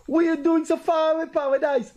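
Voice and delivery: a high, surprised hail across the street; imitating a stranger's astonished greeting. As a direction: call out high, amazed to see him there. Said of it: "this guy said to me".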